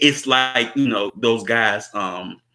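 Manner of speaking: ordinary talk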